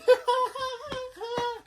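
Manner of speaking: very high, drawn-out mock cackle; hammed-up high laugh at someone's humiliation; gloating laugh. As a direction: cackle high and long, in character. Acting Missus Wong's glee.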